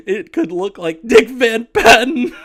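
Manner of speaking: breaking into laughter mid-line; words dissolving into giggles; laughing through words. Real, not acted. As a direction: laugh out the punchline. Laughing while reading letter.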